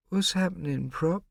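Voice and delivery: put-on high little boy, drowsy; just woken small child mumbling; sleepy small boy voice; sleepy mumble. A narrator's reading. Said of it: "he mumbled sleepily".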